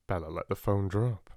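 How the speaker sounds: plain reading voice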